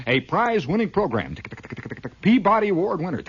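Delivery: high, loud announcer boast; mock TV announcer. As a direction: sell the prize like a proud promo voice.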